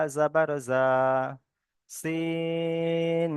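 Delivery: letter names like "সিন", "মিম", "নুন" stretched very long on one steady note; slow chant-like Qaida spelling, "জাবার" after each held letter; pronunciation drill. Shown as a demonstration. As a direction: hold "শিন" long and level, then say "জাবার" and the syllable. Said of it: "কেমন ভাবে উচ্চারণ হবে দেখেন".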